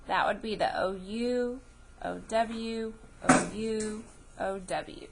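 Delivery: ordinary talk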